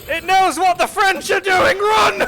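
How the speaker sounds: high, loud, hammed-up alarm; shrieking playful panic; mock-panicked shout. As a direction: scream a frantic warning to flee. Panicked yelling at game creature.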